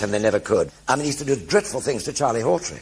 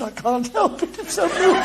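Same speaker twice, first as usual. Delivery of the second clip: tearful reply. Retold or imitated.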